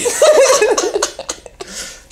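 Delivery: high squealing laugh that falls away; burst of laughter. Real, not acted. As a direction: let a real laugh break out high and drop. Laughs at the [name] joke.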